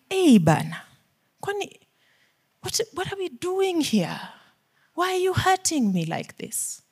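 high, soft pleading to God; plaintive complaint. Acted. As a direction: plead like a hurt child of God. Acts a hurt believer questioning God.

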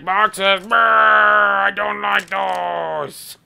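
high, slow disgusted voice; Gordon Freeman voice. Retold or imitated. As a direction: shudder at the boxes. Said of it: "He's like".

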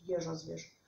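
ordinary delivery, nothing marked

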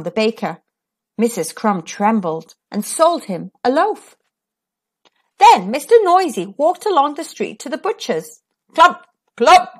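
usual speaking voice

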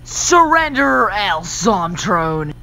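slow, drawn-out demand to surrender; a touch of hammed-up hero; heroic demand. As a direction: stand tall and order the villain down. Hero confronts villain.

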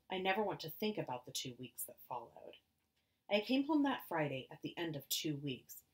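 ordinary delivery, nothing marked

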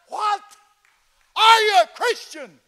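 loud, high shouted question; shouting over engine. Retold or imitated. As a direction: yell it over the engine noise. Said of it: "it's loud in there".

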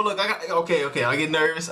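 usual speaking voice

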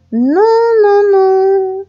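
put-on high, sung-out old granny refusal; refusing grandmother. A narrator's reading. Grandmother rejecting the wolf's plan, high drawn-out protest. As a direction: draw out the refusal high and held.

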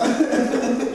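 high giggle; laughing at faked crying. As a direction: burst out laughing. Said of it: "je fais semblant".

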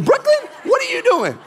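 very high-pitched mock scolding; mock scolding dad. Daughter repeats her mimicry of dad, mock scolding. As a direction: exaggerate a parent's startled telling-off. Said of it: "I don't talk like that".

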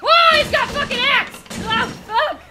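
high, loud startled yell; alarmed shout. Real, not acted. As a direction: cry out in shock at an attacker. Startled by enemy with an axe.

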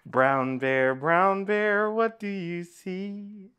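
chanted refrain, leaping high and loud on the repeat; sing-song story reading. Acted. Reader to children with picture book, sing-song chant. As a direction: lilt it musically for little listeners.

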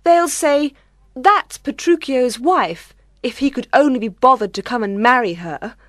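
high, upset bride's voice; acted humiliated lament; close to tears. A narrator's reading. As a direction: complain in a high voice, working up to tears. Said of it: "She began to cry".

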